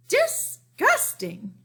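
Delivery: very high, slow, drawn-out disgust; hammed-up disgust. A narrator's reading. Cousin Garland reacting to baby Julius, hammed-up disgust. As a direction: stretch it out with theatrical distaste.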